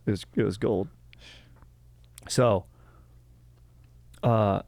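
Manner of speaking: usual speaking voice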